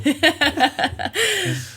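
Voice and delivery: a high burst of real laughter; laughing. Real, not acted. Host laughing at the guest's rodeo quip, high bright laugh.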